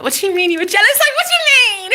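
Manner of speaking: high, rising mock disbelief; retold shriek of amazement; incredulous squeal. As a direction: shoot the pitch way up in astonishment. Acted astonishment at ex.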